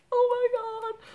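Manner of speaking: high-pitched, squealed reenactment of relief; retold overjoyed gasp. Shopper to the lady returning her lost wallet, overjoyed squeal.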